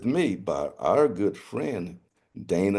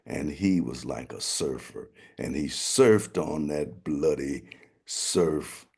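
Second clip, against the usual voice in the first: ordinary delivery, nothing marked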